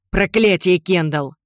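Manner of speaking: loud angry curse; spat out. Acted. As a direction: spit the curse at a daughter. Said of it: "выплюнул Джим". (гневно выплёвывает)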